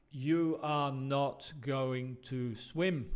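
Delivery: slow, every word spelled out; the full uncontracted form, said slowly. Shown as a demonstration. Teacher shows what natives do not say, slowly and fully. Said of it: "no decimos".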